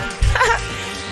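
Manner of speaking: high breathy cackle; a gleeful evil snicker; sinister giggle. Acted. Announcer giggles.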